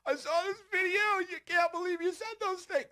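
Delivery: high, put-on shocked voice; mocking idiots. Ridiculing clip-sharing idiots, high shocked squeal. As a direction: squeak it like a clueless critic. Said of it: "and then idiots".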